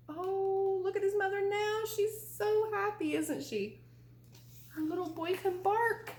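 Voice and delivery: high, bright happy exclaim; delighted. A narrator's reading. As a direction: share the joy with the children. Joy at happy ending.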